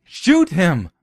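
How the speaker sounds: high shouted command; high barked order; harsh order. Acted. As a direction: demand they kill him now. Nelson demands the shooting.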